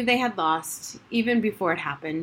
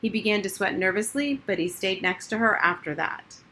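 usual speaking voice

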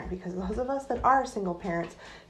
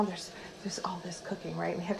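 whisper; 10 dB quieter than usual. Real, not acted. Aside while cooking in kitchen.

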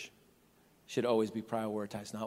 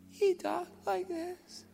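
very high, squeaky little man; comically high-pitched squeaky impression. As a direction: squeak it out slowly. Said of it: "he has a squeaky voice".

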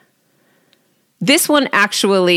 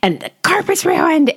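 high, frantic rush of panic; freaking out; 9 semitones higher than usual. Retold or imitated. Mom reliving a ruined morning, high frantic panic. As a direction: blurt the worries out in a rising panic. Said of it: "freaking out".

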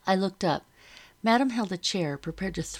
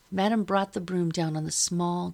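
plain reading voice

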